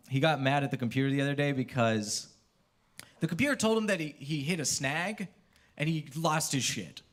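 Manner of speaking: ordinary talk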